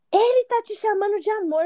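high-pitched mock outburst; imitated freak-out. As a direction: act out the jealous ex losing it. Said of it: "já começou a surtar". (imitando um surto de ciúme, voz aguda)

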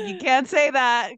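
high, drawn-out playful reprimand; mock scolding. Stripper telling off her elderly regular, mock scandalised. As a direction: scold him in scandalised disbelief.

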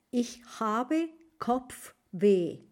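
very slow, clear German phrase; teacher modelling a German phrase; slow model phrase. Shown as a demonstration. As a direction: say it slowly, word by word. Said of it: "otra fórmula de decir".

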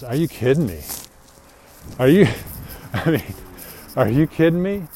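are you serious, loud and stunned; incredulous. Retold or imitated. Reacting to sister wanting nothing to do with mom, loud disbelief.

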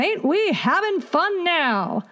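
high, drawn-out skull voice; gleeful talking skull. A narrator's reading. Head bone taunts the dancers, gleeful. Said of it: "said the head bone".

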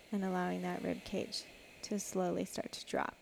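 guided breathing. Shown as a demonstration. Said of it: "coach cueing breathing exercise".

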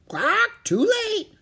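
high loud squawking parrot. Acted. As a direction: screech it like a bird. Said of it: "squawked Polly".

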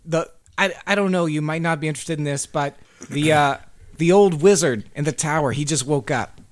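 a hint of a friendly gardener. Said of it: "the gardener says".

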